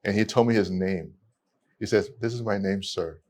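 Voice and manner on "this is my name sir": softly mumbled by a shy student; timid quiet reply. Retold or imitated. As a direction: say it meekly, barely audible. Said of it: "I can't hear you".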